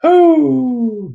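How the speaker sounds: long high hoot after laughing; high "whoo". Real, not acted. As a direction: let out a loud falsetto "ooh", sliding down. Exhales after laughing at his imitation.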